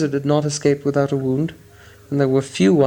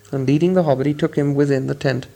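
ordinary delivery, nothing marked

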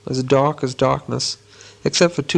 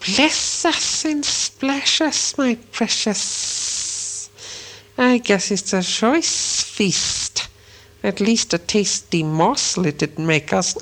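very high, slow, hissing creature voice, breath leaking through; Gollum's whisper and hiss; 6 semitones higher, much slower than usual. A narrator's reading. Gollum sizing up Bilbo as food, high slow hiss. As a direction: stretch each word like a slimy cave creature gloating. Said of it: "up came Gollum and whispered and hissed".

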